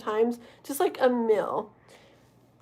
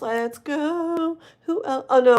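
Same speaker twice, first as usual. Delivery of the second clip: ordinary talk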